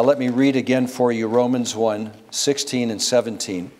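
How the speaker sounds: ordinary talk, nothing marked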